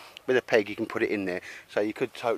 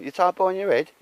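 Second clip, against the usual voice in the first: high, loud voicing of a kid asking; quoting the boy's high question; mock child's question; 10 semitones higher, 7 dB louder than usual. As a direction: squeak it out like the boy, loud. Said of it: "He said".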